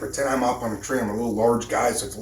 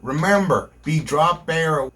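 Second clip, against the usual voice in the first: careful slow correction; 4 semitones higher, 3 dB louder than usual. Real, not acted. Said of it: "I can't talk".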